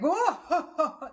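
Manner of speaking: high, laughing whoa as a merry Santa. Retold or imitated. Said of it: "Santa's like".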